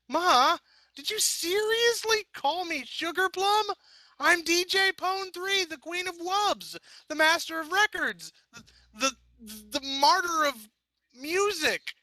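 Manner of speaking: very high, slow, flustered boasting; indignant rant. A narrator's reading. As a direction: puff herself up in outrage. Vinyl's indignant self-titles.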